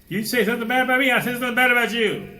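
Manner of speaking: put-on high, loud, fast childish retort; mock petty tit-for-tat. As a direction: sneer like a petty quarreler. Mocking petty retaliation.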